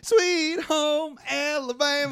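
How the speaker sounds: mock singsong title. Real, not acted. Joking guess of song title.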